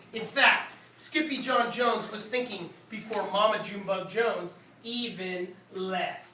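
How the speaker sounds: plain reading voice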